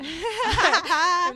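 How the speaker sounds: amused shopper answering the cashier; laughing. Retold or imitated. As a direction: giggle out the answer.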